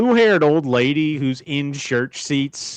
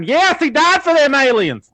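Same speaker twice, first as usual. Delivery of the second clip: high-pitched zealous church lady, carried away; excited church lady; 9 semitones higher than usual. Retold or imitated. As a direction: shout it out in rapture. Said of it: "I got a little excited there".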